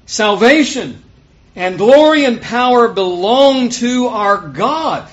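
high, loud, slow proclamation; drawn-out exultant declaration; crying out. A narrator's reading. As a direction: proclaim it as a multitude's praise. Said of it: "multitude in heaven crying out".

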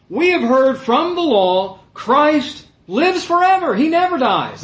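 high, loud, slow declamation; crowd's insistent protest. Acted. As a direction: hammer out the objection slowly. Acts out crowd answering Jesus.

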